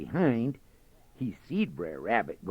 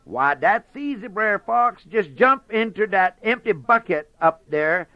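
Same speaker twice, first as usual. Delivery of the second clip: higher, louder bragging rabbit; boastful Brer Rabbit; 11 semitones higher, 10 dB louder than usual. Acted. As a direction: sell the tall tale proudly.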